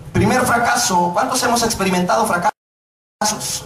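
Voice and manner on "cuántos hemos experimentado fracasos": ordinary delivery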